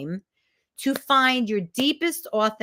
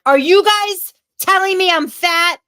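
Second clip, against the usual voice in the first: loud, high, accusing shout; angry outburst; 9 semitones higher, 11 dB louder than usual. Retold or imitated. Actor yelling at her class after reading the script, angry shout. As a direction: hurl it at the whole room.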